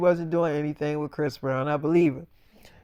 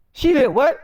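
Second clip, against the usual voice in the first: louder, higher, stunned question; shocked disbelief; 5 semitones higher, 5 dB louder than usual. Retold or imitated. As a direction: hit it like you can't believe your ears. Hearing about the cartoon series.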